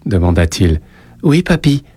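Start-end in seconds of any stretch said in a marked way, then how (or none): 1.2-1.8 put-on high child's voice; small, high-pitched reply; little boy. A narrator's reading. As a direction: answer grandpa as an obedient small boy. Narrator voices boy answering grandpa.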